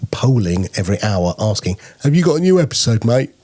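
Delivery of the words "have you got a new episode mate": higher, fast, nagging voice of an impatient app; mock pestering app. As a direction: badger the host like a needy app checking in. App pestering the host hourly.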